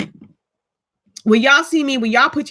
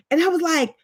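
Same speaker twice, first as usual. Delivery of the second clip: ordinary talk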